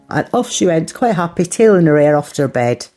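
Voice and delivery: ordinary talk, nothing marked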